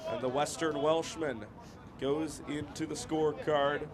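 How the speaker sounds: emphatic football commentary, easing down at the end; live play-by-play. Real, not acted. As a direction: call the booking, stretching out the player's name. Calls a yellow card live.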